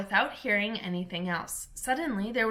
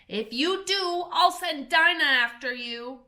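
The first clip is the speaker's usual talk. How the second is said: a touch higher, raised call; calling out loud; 6 semitones higher, 5 dB louder than usual. A narrator's reading. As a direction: threaten them across the yard. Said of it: "Alice called out loud as she could".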